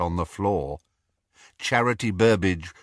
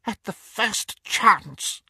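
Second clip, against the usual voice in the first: eager witch; 12 semitones higher, slower than usual. A narrator's reading. Bellatrix eager vow.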